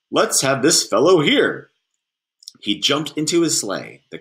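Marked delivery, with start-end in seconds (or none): 0.1-1.7 put-on louder, hearty Santa choosing a child; booming and cheerful, lightly voiced; jolly Santa. A narrator's reading.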